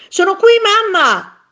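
high, loud call to mum; child shouting. A narrator's reading. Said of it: "gridò Tonino". (grido acuto e forte)

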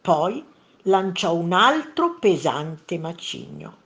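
usual speaking voice

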